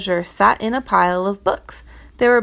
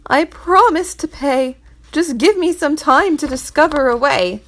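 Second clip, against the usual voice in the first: pleading; 6 semitones higher, 4 dB louder than usual. Acted. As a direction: plead for more time. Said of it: "cried the poor man".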